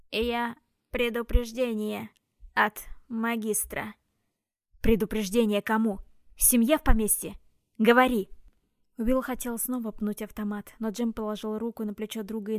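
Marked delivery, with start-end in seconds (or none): 0.1-3.9 slow, grinding machine voice; metallic robot voice. Acted. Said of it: "металлический голос проскрежетал". 4.8-8.3 loud, furious demand; angry shout. Acted. Will shouting at the automaton.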